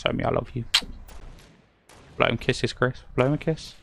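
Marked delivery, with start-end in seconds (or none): none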